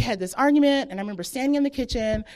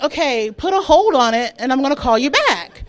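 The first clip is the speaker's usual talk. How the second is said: louder, higher retold instruction; urgent; 4 semitones higher, 8 dB louder than usual. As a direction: firmly place the hold, projecting.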